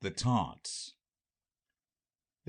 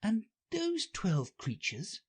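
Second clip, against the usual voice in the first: put-on slow, high girlish voice; Alice to herself; 11 semitones higher, slower than usual. Alice names what she sees in court, high musing voice. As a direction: work it out aloud, high and unhurried. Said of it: "thought Alice".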